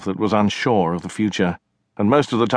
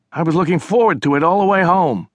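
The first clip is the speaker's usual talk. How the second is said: higher, louder aggrieved complaint; indignant sister; 10 semitones higher, 4 dB louder than usual. Acted. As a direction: protest the stolen water, annoyed. China complains to brother.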